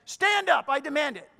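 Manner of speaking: loud, high barked mock order; demanding. Shown as a demonstration. Pastor demonstrating a demand on someone listening, demanding. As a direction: suddenly order someone to their feet. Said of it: "Demand you to do anything".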